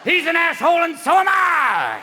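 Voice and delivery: loud, high comic bellow; exposing shout. Acted. Politician's backside exposing him, loud shouted confession. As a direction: holler it out as a public confession.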